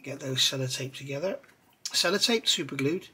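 normal talking voice

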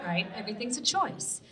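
usual speaking voice

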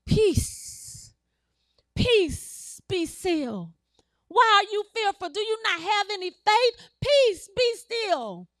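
high, forceful preacher's command; commanding rebuke. Acted. Jesus rebuking wind and sea, commanding cry. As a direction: order the storm down with authority. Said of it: "he said unto the sea".

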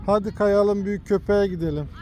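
ordinary delivery

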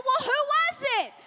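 high, insistent retold question; mock urgent demand. Mom asking who was at the door, acted alarm.

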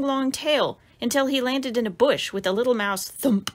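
usual speaking voice

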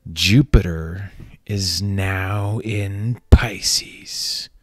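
slow hushed whisper; mystical whisper. Acted. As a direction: breathe it like an oracle. Astrology forecast whispered slow.

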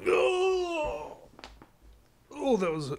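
high strained groan while stretching. Real, not acted. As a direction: groan through a big stretch. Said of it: "I'm stretching".

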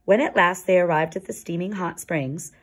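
ordinary delivery, nothing marked

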